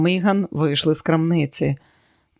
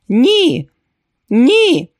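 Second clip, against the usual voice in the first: high, loud screams of NO; screaming; 9 semitones higher, 7 dB louder than usual. A narrator's reading. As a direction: scream it out twice, voice leaping high. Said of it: "закричала вона".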